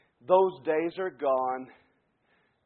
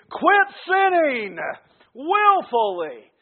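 loud, raised preacher's cry; full-force preaching; 10 semitones higher, 11 dB louder, slower than usual. Real, not acted. Preacher making his first point, quit sinning, fervent loud cry. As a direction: cry it out with fervor.

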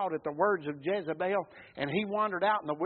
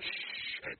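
long soft shush; hushing shh; 13 dB quieter than usual. Retold or imitated. Crowd hushes blind Bartimaeus, imitated hushing shh. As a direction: shush someone with pure hiss.

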